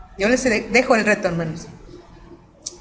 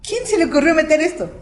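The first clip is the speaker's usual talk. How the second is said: mock complaint; 7 semitones higher than usual. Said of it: "y yo así como que".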